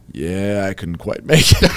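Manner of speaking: low-pitched, lightly imitated sheepish colleague; deadpan admission. Retelling his partner's bathroom excuse, low deadpan. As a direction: quote him in a low, offhand voice. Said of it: "He's just like".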